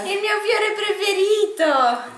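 high, delighted gasps over a gift. Real, not acted. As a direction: coo over something cute.

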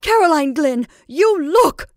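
high, loud cry of alarm. Acted. Mrs Brigham to Caroline at the shadow, alarmed cry. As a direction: urgently make her look.

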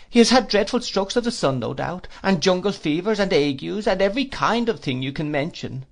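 put-on high, fluttery elderly mother; fussy old lady. A narrator's reading. Old lady voiced in story.

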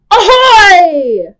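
high, loud pirate shout; ahoy shout. Acted. Storyteller shouts ahoy to the children. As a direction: yell it like a pirate captain. Pirate call after countdown.

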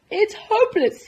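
high, loud wail; despairing cry. Acted. Baker failing to invent the roll, despairing cry. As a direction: cry out in frustration. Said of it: "he cried".

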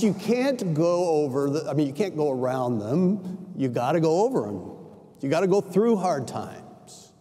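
normal talking voice

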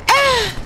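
short high-pitched scream; startled scream. Real, not acted. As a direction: scream in sudden fright. Startled by the game.

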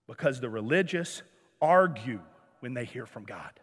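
low, soft drop for emphasis; hushed, grave answer. Real, not acted. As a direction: sink almost to a murmur for the point. Grave hushed answer to own question.

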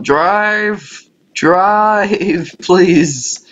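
long drawn-out, pleading cry; exasperated pleading. Real, not acted. Player begs the slow car to drive, exasperated pleading. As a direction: stretch each word out, begging through a laugh.

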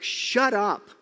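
loud, high barked Shut up; sharp rebuke. Retold or imitated. Jesus rebuking the wind, a loud sharp shout. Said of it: "The best way to see it is something like".

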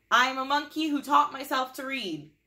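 loud, high silly monkey voice; hammed-up monkey announcing itself. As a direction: play a proud cartoon monkey, loud and high. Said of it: "in my monkey voice".

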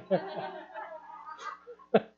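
high burst of laughter. Real, not acted. Laughs at his own joke.

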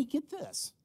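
usual speaking voice

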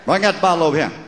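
Southern accent. Shown as a demonstration. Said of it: "the Southern version of the word".

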